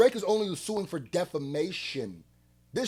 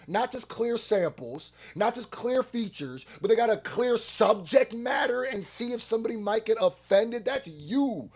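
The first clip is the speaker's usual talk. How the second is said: ordinary delivery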